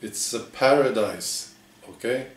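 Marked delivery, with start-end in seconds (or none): none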